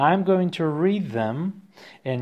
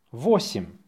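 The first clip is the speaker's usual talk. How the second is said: brisk dictation repeat; faster; 3 semitones lower than usual. Shown as a demonstration. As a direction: say the word short and quick.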